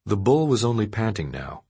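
ordinary delivery

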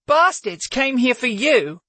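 slow, high, voice cracking. Acted. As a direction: hold back tears, voice breaking. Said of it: "her voice cracking with emotion".

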